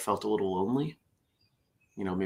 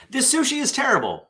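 high, indignant complaint; disgusted customer; 4 dB louder than usual. Acted. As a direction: complain in a raised voice. Acted detective line in joke.

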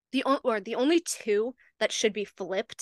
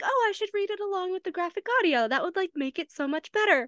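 higher, quick hopeful chirp; bright idea; 8 semitones higher, faster than usual. Retold or imitated. Host recalls planning to read along, hopefully upbeat. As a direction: retell a happy little brainwave.

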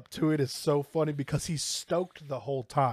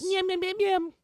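high sing-song. Pretend. Playful food noise.

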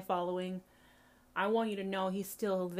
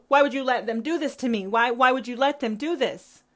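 loud, raised, pleading cry; anguished questioning; 4 semitones higher, 10 dB louder than usual. Acted. Hurt child asking God why this was allowed, anguished. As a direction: demand an answer from God, loudly. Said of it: "you kept wondering".

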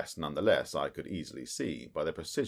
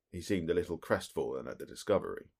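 ordinary delivery, nothing marked

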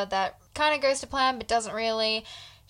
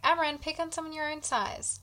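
higher, softer mock protest; mildly standing up for someone, pitched up; a bit defensive; 6 semitones higher, 5 dB quieter than usual. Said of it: "Cassian's a bit defensive over Elaine".

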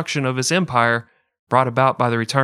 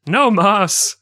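"No mas" pitched much higher, put-on voice; hammed-up "No mas"; 8 semitones higher, 5 dB louder than usual. Shown as a demonstration. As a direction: squeal "No mas" in a mock voice. Repeating phrase, second exaggerated.

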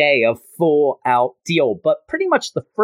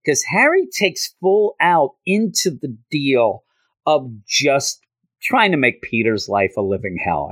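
ordinary talk, nothing marked

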